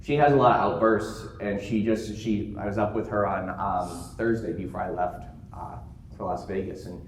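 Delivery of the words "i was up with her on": usual speaking voice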